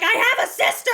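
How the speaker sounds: high, loud yell of shock and joy; retold excited scream. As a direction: scream the news upstairs. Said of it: "I started screaming upstairs".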